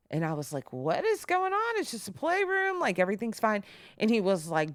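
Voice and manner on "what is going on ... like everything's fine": high, baffled reassurance; retold puzzled plea in a high voice; high-pitched coaxing. Mother to frightened son at the playroom, high coaxing.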